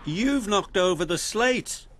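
mild cry of alarm. A narrator's reading. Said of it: "cried his banksman".

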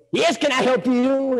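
high, slow put-on old-neighbor voice; drawn-out, unwelcoming greeting in character; hostile neighbor. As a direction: answer the door like a suspicious crank. Gruff neighbor answers the door.

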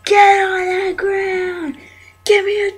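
barking cop orders, high and loud; shouted surrender demands. Acted. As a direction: yell like an angry cop. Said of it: "I'm a police officer".

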